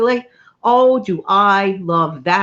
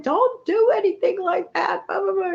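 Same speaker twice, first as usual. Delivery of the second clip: husband's lecture; 13 semitones higher than usual. Retold or imitated. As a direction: parody his warning. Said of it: "He said, he was like".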